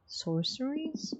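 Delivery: drawn-out puzzled echo; incredulous. Acted. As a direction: repeat it as if it's absurd.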